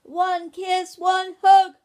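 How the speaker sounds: put-on high, very loud child's yell; bellowed shout. A narrator's reading. Ben shouts down to his parents, bellowing. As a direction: bellow it down the stairs. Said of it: "bellowing down the stairs, Ben shouted".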